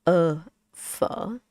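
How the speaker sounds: careful "Uh, pho" with the air stopped and no O before it; "Uh, pho" as a teacher's model; demonstrated pronunciation. Shown as a demonstration. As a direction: sound out "Uh, pho" slowly as separate sounds. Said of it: "You just say".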